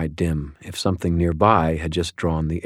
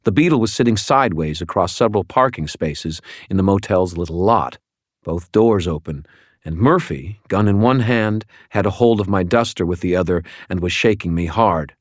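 ordinary delivery

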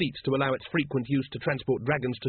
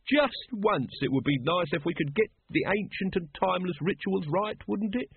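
exasperated, high-pitched lodge leader; Brother Watchtower; 6 semitones higher than usual. Acted. Brother Watchtower scolding over the botched ritual, exasperated. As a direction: complain wearily in a higher character voice.

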